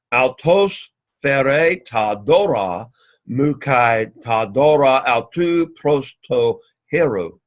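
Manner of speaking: slow, deliberate reading of the Greek; unhurried classroom recitation. Shown as a demonstration. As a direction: read each word out slowly for the class. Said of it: "let's say it again".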